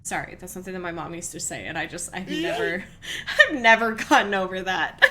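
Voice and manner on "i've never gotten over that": laughing-crying through the words; laughing hard. Real, not acted. Remembering her mom's saying, laughing hard and louder. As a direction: breaks into helpless laughter.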